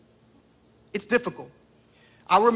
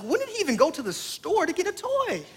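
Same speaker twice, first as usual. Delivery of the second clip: much higher puzzled wondering; 11 semitones higher than usual. Retold or imitated. Father puzzling to himself over the toy, high and baffled. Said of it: "I was like".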